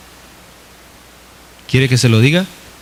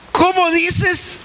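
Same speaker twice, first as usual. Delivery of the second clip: very high, slow, drawn-out "¿Cómo dices?"; bewildered high question; 4 dB quieter, much slower than usual. Retold or imitated. Preacher acting out asking the Lord to repeat, in a high, slow, stretched question. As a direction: stretch it way up in disbelief, softer. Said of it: "así".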